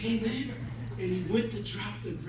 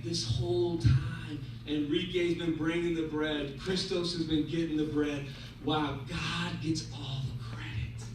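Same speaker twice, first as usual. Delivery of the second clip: low, measured, calm explanation; wise rabbi; 6 semitones lower, 4 dB quieter than usual. Acted. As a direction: lay it out patiently for them.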